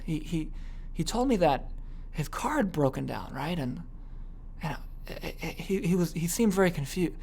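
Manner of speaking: dropped, hushed voice with halting pauses; whisper tone. Shown as a demonstration. Gossiping about a business partner, hushed whisper tone. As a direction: confide it softly, drawing out the suspense. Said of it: "because I dropped my tonality".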